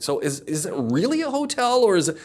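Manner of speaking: high, baffled double-check; puzzled question. Retold or imitated. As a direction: pitch jumps way up in disbelief. Asking Kev a puzzled question.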